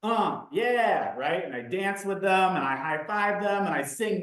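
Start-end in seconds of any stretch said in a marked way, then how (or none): 0.0-1.2 karaoke hype. Shown as a demonstration. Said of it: "I do a lot of".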